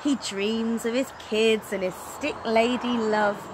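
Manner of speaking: ordinary delivery, nothing marked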